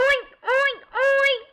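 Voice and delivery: high loud oinks; oinking. Acted. Robot pig oinking at the wolf, high. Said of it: "said the robot pig".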